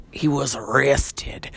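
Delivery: slow, hushed true-crime whisper; slow hushed imitation of a reality-show voice; Angela whisper. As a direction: breathe it like a gossip sharing a scandal. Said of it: "I sound like Angela from".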